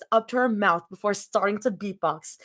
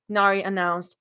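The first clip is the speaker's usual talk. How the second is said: ordinary delivery, nothing marked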